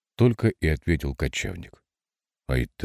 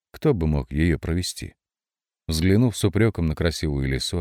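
ordinary delivery, nothing marked

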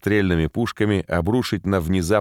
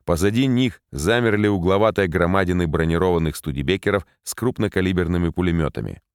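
ordinary delivery

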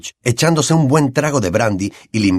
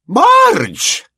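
high, loud alarmed cry; shouting Marge; 5 dB louder than usual. Acted. Uncle and aunt cry out as Marge inflates, high loud alarm. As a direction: yell the name in panic. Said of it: "gritaron a la vez".

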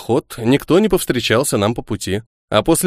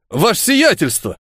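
surprised; 7 semitones higher than usual. Acted. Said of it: "удивился бывший унтер-офицер".

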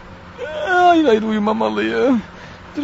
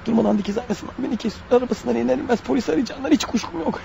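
quiet inner monologue; 8 dB quieter than usual. Acted. Killer muttering his suspicions about the driver, quiet. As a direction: keep it hushed, as if the driver must not hear.

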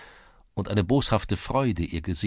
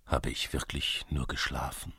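low, soft wondering to himself; asking himself; 5 semitones lower, 6 dB quieter than usual. A narrator's reading. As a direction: think it aloud, quietly and low. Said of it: "frug sich Levko". (leise und tief sich selbst fragen)

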